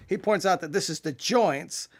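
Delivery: ordinary talk, nothing marked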